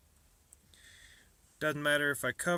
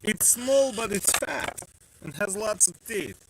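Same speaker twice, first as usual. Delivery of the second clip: higher, louder mock sales pitch; mock showman; 7 semitones higher, 6 dB louder than usual. Maker showing a sprocket to viewers, hammed-up pitch. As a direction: sell the sprocket like a hammy pitchman.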